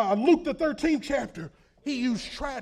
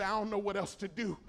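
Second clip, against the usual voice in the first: despairing mother; 2 semitones higher, 8 dB louder than usual. Retold or imitated.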